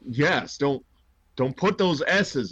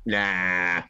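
lazy brush-off; 7 semitones lower, much slower than usual. Retold or imitated. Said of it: "he's like".

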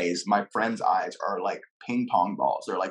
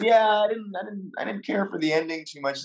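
higher-pitched, stammered imitation of a customer; hesitant critic; 9 semitones higher than usual. Customer tells the actor what he disliked, hesitant imitation. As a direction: stumble into mild criticism, pitched up.

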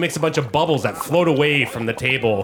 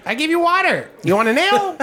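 high, chirpy innkeeper gnome; perky little host pushing drinks, pitched way up; Gilly the gnome; 4 dB louder, faster than usual. Acted. Gnome innkeeper offering ales, high and chirpy.

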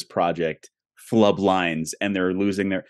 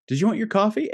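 mock bit-part line; 12 semitones higher, much faster than usual. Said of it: "your line is".

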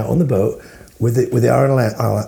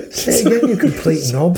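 "Say again" and insults laughed out, high and slow; laughing; 10 semitones higher, much slower than usual. Real, not acted. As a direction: laugh through the words in disbelief. Bursts out laughing at question.

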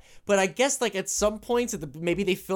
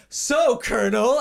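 mock prisoner voice; 5 semitones higher, 4 dB louder, slower than usual. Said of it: "as he was like".

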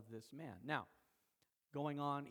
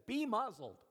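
loud, raised-pitch order to be silent; forceful command; 6 semitones higher, 5 dB louder than usual. Acted. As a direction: deliver it as a powerful rebuke. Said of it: "That's what it came across like".

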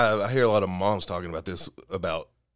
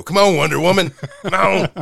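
loud, high mock cry of passion; over-the-top pleading shout; acted urging; 10 semitones higher, 10 dB louder than usual. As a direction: call out in hammed-up excitement. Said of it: "likes to go".

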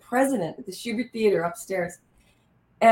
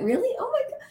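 much higher, breathless gasp of surprise; stunned disbelief; 14 semitones higher, 3 dB quieter, faster than usual. Retold or imitated. Actress just told she got the role, stunned. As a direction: squeal it like you can't believe your luck. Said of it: "I went".

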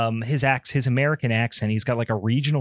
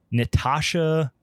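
ordinary delivery

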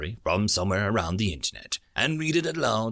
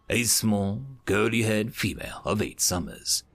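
ordinary talk

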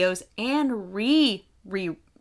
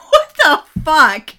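laughing disbelief; 8 semitones higher, 13 dB louder, slower than usual. Real, not acted. Laughing shock at the email.

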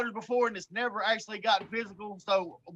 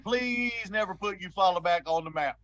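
usual speaking voice

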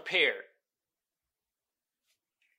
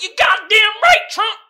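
Jim Bob; 14 semitones higher, 12 dB louder than usual. Retold or imitated. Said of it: "And Jim Bob was like".

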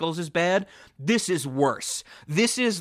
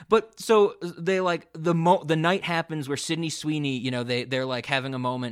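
ordinary talk

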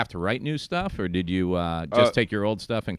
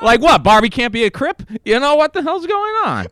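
high, loud comic indignation; hammed-up outraged protest; mock outrage; 13 semitones higher, 9 dB louder than usual. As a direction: rant in disbelief, pitch shooting up. Mock outrage at the doll's name.

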